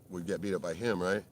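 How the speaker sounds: ordinary talk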